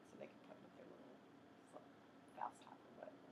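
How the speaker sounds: unvoiced whispered aside. Real, not acted. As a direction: say it under your breath. Aside while demonstrating.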